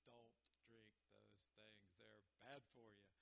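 slow, low, quiet doctor's caution; doctor's warning. Retold or imitated. Doctor tells a teenager with health issues, slow hushed warning. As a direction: spell it out slowly and softly, like a grave warning.

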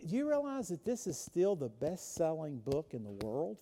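ordinary talk, nothing marked

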